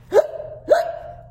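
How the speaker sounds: sharp high gasping hiccup intake. Acted. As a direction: gasp in like a hiccup. Hiccup gasp before hick.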